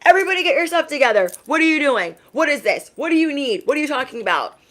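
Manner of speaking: flustered mom yelling in an emergency; impatient panicked yelling. Retold or imitated. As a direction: bark orders in a panic, very high. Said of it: "I'm like".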